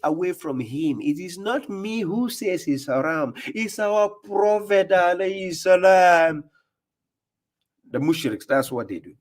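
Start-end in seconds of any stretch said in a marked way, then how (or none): 1.0-6.4 higher, slower, sing-song parody of the preacher; mocking imitation. Host mocking the preacher from the clip, slow high parody. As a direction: drag it out like you're ridiculing his sermon.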